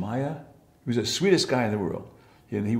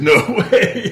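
laughing no way; 9 semitones higher, 9 dB louder than usual. Retold or imitated. Friend agrees with a laugh.

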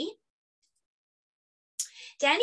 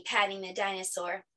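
plain reading voice

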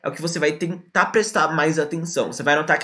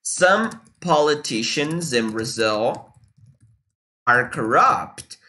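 very slow, carefully spaced words; "corrupt" drawn out and clear; slow teaching demo; 3 semitones lower, much slower than usual. Shown as a demonstration. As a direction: drag it out for students to copy. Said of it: "So, for example".

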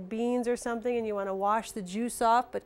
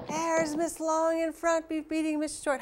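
higher, excited race commentary; race call; 6 semitones higher than usual. Pretend. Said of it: "have a little horse race".

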